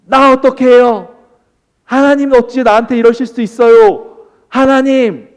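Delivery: loud, high-pitched mock wailing at God; acted frantic outcry. Preacher acts out us protesting to God before the mountain, frantic outcry. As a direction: cry out in hammed-up panic and protest. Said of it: "이렇게 난리 부르스를 쳤는데".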